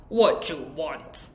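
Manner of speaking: slow, low and rough; raspy voice. Shown as a demonstration. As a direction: rasp the question out slowly. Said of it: "So it's a raspy voice".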